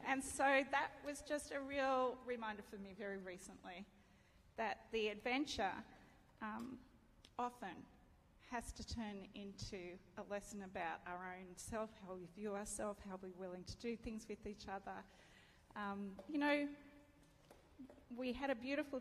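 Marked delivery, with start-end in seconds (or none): none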